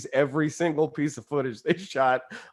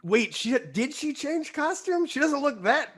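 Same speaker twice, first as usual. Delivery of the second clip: high, softer puzzled inner voice; wondering aloud, high and hushed; confused inner voice; 9 semitones higher, 4 dB quieter than usual. Retold or imitated. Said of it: "and I was like".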